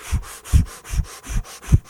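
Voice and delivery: airy, voiceless pumping puffs; imitated dry pump. Preacher shows congregation an unprimed pump, imitated airy puffs. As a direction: puff out the sound of a pump sucking air.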